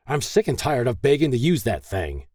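angry railing. A narrator's reading. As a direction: rail at them through the door. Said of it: "he railed".

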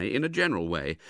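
ordinary delivery, nothing marked

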